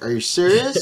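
can't believe what he's hearing; incredulous. Retold or imitated. Answering the vendor who won't haggle, incredulous. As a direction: pitch jumps high in mock disbelief.

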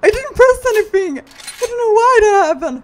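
high, loud shriek of disbelief; panicked yelling. Real, not acted. Player shocked by what the game just did, panicked yelling. Game suddenly goes wrong.

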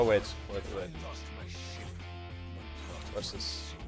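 usual speaking voice

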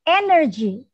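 Energy said clearly for repeating; modelled word. Shown as a demonstration. Teacher models the word for children, clear and careful. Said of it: "So this is the first word".

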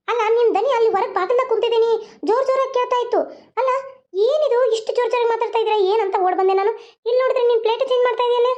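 grandma hurrying in, nosy and scolding. Acted. Grandma asks the sisters about the shouting, high fussy old voice.

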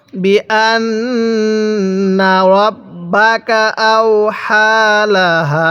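very slow, drawn-out syllables; model recitation. Shown as a demonstration. Teacher models Quran words for learners, slow measured recitation. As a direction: stretch each long vowel evenly. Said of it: "কিভাবে পড়ব".

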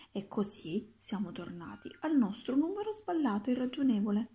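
plain reading voice

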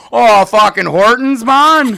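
loud, high hammed-up Canadian; exaggerated Canadian. Canadians answering Americans about Tim Hortons, hammed Canadian. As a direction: crank the Canadian accent way up. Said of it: "a Canadian about something very Canadian".

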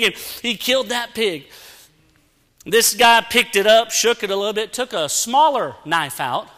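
usual speaking voice

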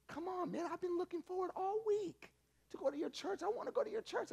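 high, eager pleading voice; pleading roommate. Acted. Roommate begs to go to church, high and eager. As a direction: beg like a kid who wants a treat.

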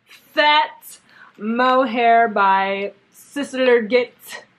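fat mohair by Sister Git drawn out slow and loud; own pronunciation. Shown as a demonstration. Vlogger naming the yarn her way, slow and loud. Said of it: "that's how I say it".

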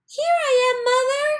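very high, loud call to the sky. A narrator's reading. Baby bird calls up to the plane, high and loud. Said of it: "he called out".

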